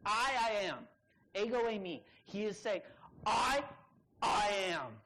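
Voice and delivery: emphatic repeated "I"; double emphasis. Shown as a demonstration. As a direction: hit "I" hard, pause, then "I am". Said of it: "It's double emphatic".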